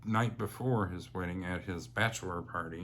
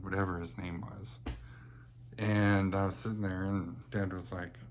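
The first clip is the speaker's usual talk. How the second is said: usual speaking voice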